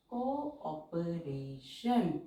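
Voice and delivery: Cooperation drawn out with को and ऑ clearly apart; very slow, vowels split apart. Shown as a demonstration. Teacher splitting the two vowels for students, very slow. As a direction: say cooperation slowly, syllable by syllable. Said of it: "दोनों को हम अलग-अलग pronounce कर रहे".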